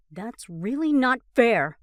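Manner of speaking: slow, loud exasperated protest; frustrated. A narrator's reading. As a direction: push back in frustration. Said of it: "Ross replies in pure frustration".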